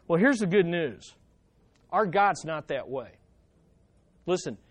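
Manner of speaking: usual speaking voice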